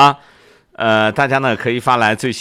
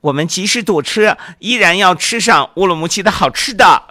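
put-on higher, determined kid's voice; child voice; 8 semitones higher than usual. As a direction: insist like a little boy. Boy Xiaoming insists.

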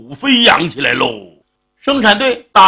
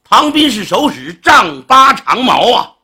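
plain reading voice

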